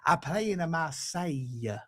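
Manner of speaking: hammed-up Italian football manager accent. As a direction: put on a comic Italian accent. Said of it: "doing an Italian accent again".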